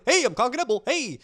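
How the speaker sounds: lightly hammed-up show-off player; mock player voice. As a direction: ham up a cocky showboating player. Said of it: "going like".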